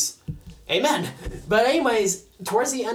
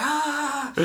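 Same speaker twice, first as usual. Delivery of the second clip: high, comic "ah" of panic; mock panicked cry; 7 semitones higher, 4 dB quieter than usual. Young man not knowing how to act, mock panic. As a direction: let out a pitched-up mock wail. Acting out panic over lost shoes.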